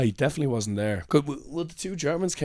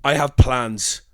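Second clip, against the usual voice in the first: mock-angry, louder refusal; retold angry snub; 6 dB louder, much slower than usual. Passer-by turning on the flyerer, retold anger. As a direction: imitate an irritated passer-by, firm and loud. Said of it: "turns to you angrily".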